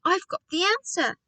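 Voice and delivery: high-pitched announcement of an idea; excited shout. A narrator's reading. Boy leaping about with the answer, excited shout. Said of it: "I shouted, leaping up".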